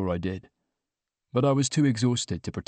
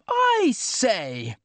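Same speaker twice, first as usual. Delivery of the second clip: high-pitched burst of enthusiasm; over-keen high exclamation; enthusiastic cry; 11 semitones higher than usual. A narrator's reading. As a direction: cry out eagerly, pitch leaping up. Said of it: "cried Alex, with fatal enthusiasm".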